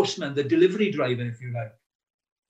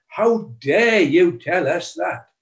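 slow, loud, higher indignant outburst; teacher's mock outrage, loud and slow; furious teacher; 4 semitones higher, 6 dB louder, slower than usual. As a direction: hurl it back at him in fury. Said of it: "afterwards furious at me".

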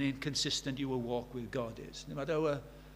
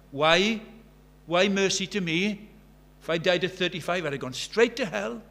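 fervent preaching; 3 semitones higher, 7 dB louder than usual. Acted. Preacher urges the congregation, loud impassioned preaching. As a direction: preach it with full fire.

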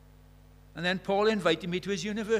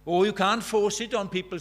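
louder, brushing-off lady voice; the old lady protesting, a bit louder; Deirdre objecting; 4 semitones higher, 9 dB louder than usual. Retold or imitated. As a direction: wave it away defensively.

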